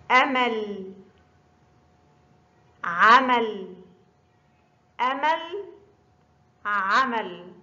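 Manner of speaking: أمل with a clean glottal stop, عمل with a throaty ع; contrast hamza and ain. Shown as a demonstration. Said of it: "هناك فرق بين نطق آ وعا". (التفريق بين الهمزة والعين في النطق)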